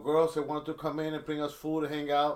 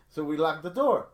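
ordinary delivery

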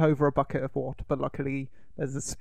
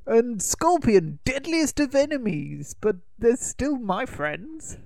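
higher, slow theatrical villain voice; villain impression; 6 semitones higher than usual. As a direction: drawl it out like a scheming sorcerer. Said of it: "Shang Tsung on the boat says".

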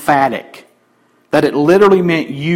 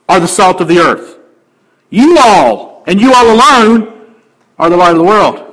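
full-force preaching; 6 semitones higher, 6 dB louder than usual. Acted. Pastor preaching fervently that all disciples are salt and light. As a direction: thunder it out to the congregation.

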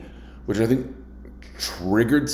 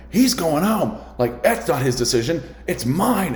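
angry manager; 7 semitones higher than usual. Retold or imitated. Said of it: "And he's all like".

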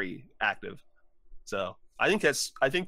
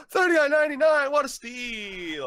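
much higher, gasping excited customer; gasping amazement; 13 semitones higher, 5 dB louder than usual. Pretend. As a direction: gasp at the price like a TV ad. Fake customer in ad bit.

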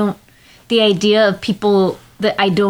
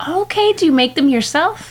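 high character voice; 7 semitones higher than usual. Acted. S1 plays along in high voice.

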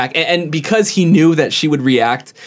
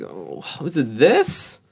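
slow, draggy mock-dumb question; acting dumb, slowed; 5 dB quieter, much slower than usual. Employee stalling a suspected shoplifter, slow and dumb. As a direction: play dumb and stretch it out. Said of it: "acting dumb and just slowing it down".

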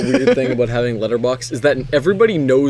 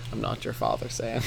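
low, very soft movie line; Jeff Bridges voice; 4 semitones lower, 15 dB quieter than usual. Retold or imitated. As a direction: murmur it low. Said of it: "Jeff Bridges when he says".

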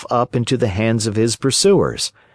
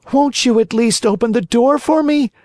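beseeching cry; 12 semitones higher than usual. A narrator's reading. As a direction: beg her to open the door. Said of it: "cried Pinocchio in a beseeching voice".

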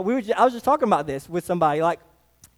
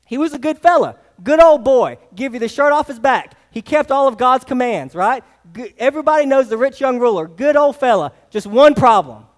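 preaching; 3 semitones higher, 4 dB louder than usual. Acted. Builds up the rich ruler.

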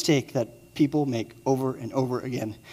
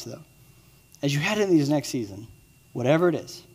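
usual speaking voice

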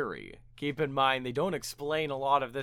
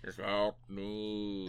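low, slow mock computer voice; synthesized voice; 8 semitones lower, 9 dB quieter, much slower than usual. Co-host mimicking the computer's cry for help, synthesized voice. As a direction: drag out a computer's plea in a lowered voice. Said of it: "the synthesized voice says".

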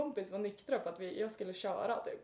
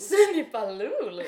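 loud, drawn-out incredulous repeat; amazed echo; 11 dB louder, slower than usual. Real, not acted. Host reacting to co-host's pet name, loud stretched disbelief. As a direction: repeat it slowly in amused disbelief.

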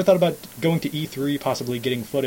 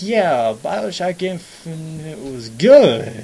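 jaded; 3 semitones higher, 6 dB louder, much slower than usual. Retold or imitated. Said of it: "I'm so jaded with everything".

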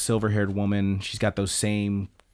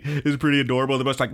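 ordinary talk, nothing marked

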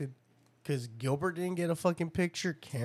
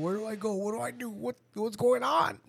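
put-on higher, lost kid voice; mock confusion; 6 semitones higher than usual. Mocking a confused child at graduation, higher. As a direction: play the bewildered kid looking around. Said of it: "didn't know what the fuck to do".

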